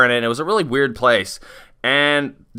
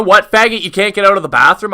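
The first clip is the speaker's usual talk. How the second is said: raised, louder jeering question; retold scornful jab, pitched up; cursing owner; 6 semitones higher, 5 dB louder than usual. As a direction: imitate an irritated owner mocking you. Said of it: "is just cursing at me".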